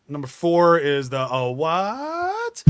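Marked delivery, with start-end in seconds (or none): 1.3-2.6 high startled "Oh, what?"; mock surprised. Quoting the film to friends, mock surprised. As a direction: play the baffled character. Quoting same movie line.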